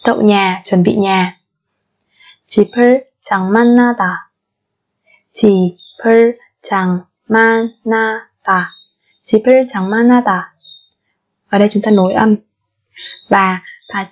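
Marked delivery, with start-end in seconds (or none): 5.3-7.8 syllable by syllable. Shown as a demonstration. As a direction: sound out 집을 장만하다 piece by piece. Slow syllable-by-syllable model. (đọc 집을 장만하다 chậm, tách từng âm)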